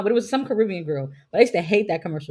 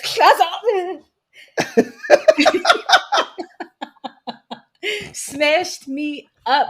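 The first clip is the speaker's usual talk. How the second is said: high and laughing, slowed way down; 9 semitones higher, 4 dB louder, much slower than usual. Real, not acted. Retelling the train doors slamming on her, laughing. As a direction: stretch it out while laughing.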